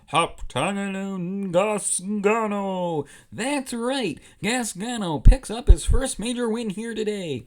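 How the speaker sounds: loud, high race-announcer voice; Fode and Bede. Acted. Race announcers breaking the news to the crowd, booming commentary. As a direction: belt it out over the intercom like sports commentators. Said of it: "over the intercom the voices of Fode and Bede saying".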